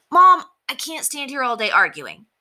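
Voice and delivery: put-on a boy cutting his mother short; mildly pressed boy's voice; hurried boy. A narrator's reading. Henry hurried on phone.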